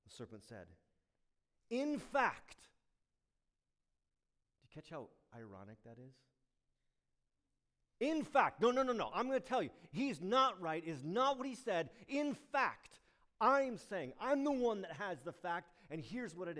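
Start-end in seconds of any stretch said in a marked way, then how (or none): 1.7-2.7 hoog en luid uitgeroepen; emphatic. Acted. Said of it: "He's emphatic". 8.0-16.6 hoog, luid, betweterig; emphatic serpent. Acted. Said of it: "He's emphatic".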